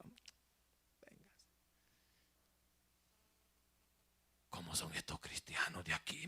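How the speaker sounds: whispered aside to a companion; retold whisper, nearly voiceless; hushed aside. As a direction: lean in and whisper it.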